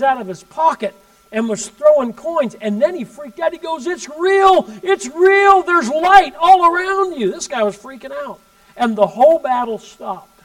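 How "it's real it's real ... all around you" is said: much higher and louder, last line slowed, a retold frenzy; rising astonished outcry; frantic awe. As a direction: shout it like a man who can't believe his eyes. Man freaks out, throws coins.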